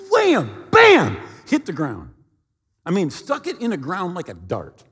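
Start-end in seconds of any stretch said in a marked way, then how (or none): 0.1-2.1 loud, slow punched wham bam; crash sound words. Retold or imitated. Preacher acting out his plane hitting the ground, loud and slow. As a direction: slam each word down like the impact.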